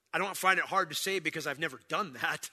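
normal talking voice

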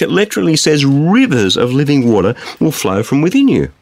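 ordinary talk

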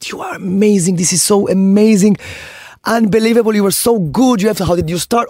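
high-pitched, admiring gush; gushing fan. Retold or imitated. Retelling a gushing fan's praise.

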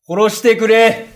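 high, loud cry to be killed; desperate plea. Acted. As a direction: throw the plea out at full pitch. Begging thief to kill him.

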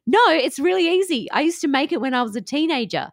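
husband animated about shortbread, eager and loud. A narrator's reading. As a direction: burst out keen, quick at the end. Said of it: "became very animated".

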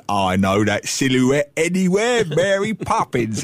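slow hammed-up movie Cockney accent. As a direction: overdo a fake Cockney accent, slowly.

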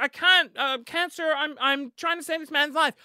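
high, urgent acted protest; pleading doctor. Doctor to gunman while giving CPR, urgent plea. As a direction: plead frantically while busy with compressions.

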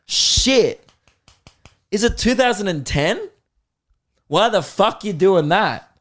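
high-pitched scornful disbelief; mock incredulity. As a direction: act baffled and scornful, pitched well up. Acts incredulous reaction to DJs.

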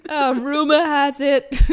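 loud laughing quip. Real, not acted. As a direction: laugh it out, drawn out. Laughing song title quip.

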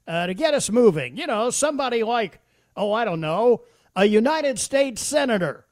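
mock announcement shouted down the crowded bus; very loud voice. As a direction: loudly call out a pointed hint for the senator to hear. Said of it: "in a very loud voice".

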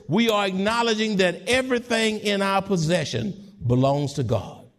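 ordinary delivery

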